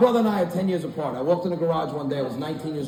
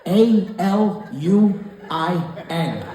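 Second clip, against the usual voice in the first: slow spelling; 2 semitones higher, 3 dB louder, much slower than usual. Shown as a demonstration.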